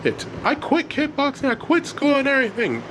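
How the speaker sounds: high, heated accusations; angry outburst. A narrator's reading. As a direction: argue back like an upset teenager. Elliot argues back in anger.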